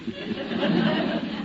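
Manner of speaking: chuckle. Real, not acted. [name] laughs at his own bet.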